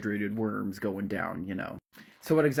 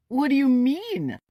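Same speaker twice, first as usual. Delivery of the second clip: high, loud disbelieving cry; stunned, pleading whine; shocked Kent; 13 semitones higher, 6 dB louder than usual. Acted. Kent begging the boys at the cellar door, high shocked cry.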